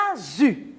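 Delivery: "Azu" as a-zu, voiced z. Shown as a demonstration. Teacher reads example to children, "Azu" with voiced z. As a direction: sound out "Azu" with a buzzing z. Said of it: "J'entends ze, mais je vois S".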